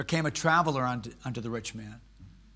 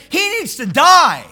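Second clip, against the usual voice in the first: loud high furious demand; angry verdict; 12 semitones higher, 16 dB louder than usual. Retold or imitated. David, enraged, condemning the thief. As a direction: spit out the death sentence. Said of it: "David's anger was greatly kindled".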